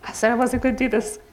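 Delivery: fighting back real tears as you speak; choked-up apology through tears; holding back tears. Real, not acted. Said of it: "I'm gonna try really hard not to cry".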